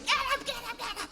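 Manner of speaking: high, rushed "get up" calls; a frantic parent rousing the kids; mock shouting parent. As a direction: parent yelling the kids out of bed. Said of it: "You start shouting".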